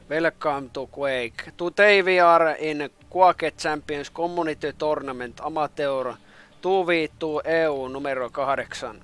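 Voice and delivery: host a Quake tournament in thick Finnish rally English; Finnish rally English accent. Shown as a demonstration. Streamer mock-announcing a Quake tournament in Finnish rally English. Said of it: "so-called rally English accent".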